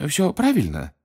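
quick high-pitched cut-in; interrupting. A narrator's reading. Mr Weasley interrupts the gatekeeper awaiting change, high cut-in. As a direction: cut him off with a lifted voice. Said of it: "перебил его мистер Уизли".

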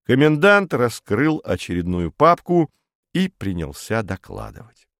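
ordinary delivery

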